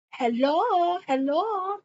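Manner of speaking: imitating an African accent, lilting hello. [name] calling out to Jacob on the beach in an African accent. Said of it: "in their nice African voice".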